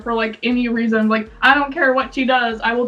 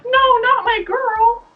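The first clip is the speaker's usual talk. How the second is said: high, drawn-out dismayed wail; hammed-up fangirl distress; mock upset; 10 semitones higher, slower than usual. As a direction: protest slowly in a high, hurt voice. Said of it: "I would always get so upset".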